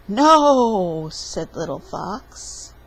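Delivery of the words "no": loud, drawn-out storybook "no"; loud refusal. A narrator's reading. Little fox refusing broccoli forest, loud refusal. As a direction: refuse loudly, stretching it out.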